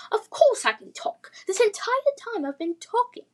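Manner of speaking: high, loud exasperated English voice; posh English accent. Acted. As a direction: huffily insist in a posh accent. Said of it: "posh English accent".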